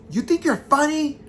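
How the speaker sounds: high, loud indignant screech; shrill mock outrage; upset. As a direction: imitate a scared friend snapping at pranksters. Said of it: "'Cause she's upset".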